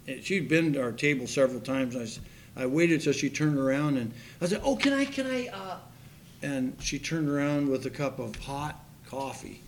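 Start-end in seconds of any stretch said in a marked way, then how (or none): 4.6-5.8 teasing call. Retold or imitated. Calls waitress back teasingly.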